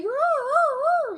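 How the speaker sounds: very high, loud imitated howl; husky mating howl. Imitating huskies mating, loud high howling. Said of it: "a fucking husky that you love".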